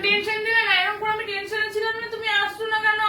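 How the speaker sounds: high, insistent complaint; agitated scolding. Acted. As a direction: let the worry spill out as reproach. Wife scolds husband for worrying her.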